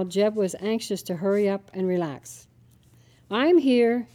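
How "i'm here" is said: put-on child calling out loud; yelled. A narrator's reading. Girl calls to her father, called out. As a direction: call out from the doorway. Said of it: "yelled Charity".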